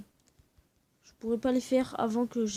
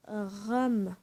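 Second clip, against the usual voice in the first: drawn out. Shown as a demonstration. Showing viewers how he says it, drawn out. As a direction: stretch the word ram very slowly. Dictating what to type.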